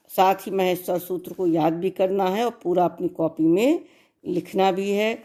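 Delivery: ordinary delivery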